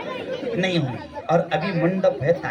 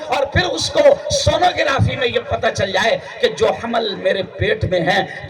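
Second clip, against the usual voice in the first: normal talking voice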